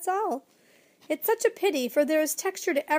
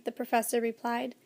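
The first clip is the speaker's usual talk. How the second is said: plain reading voice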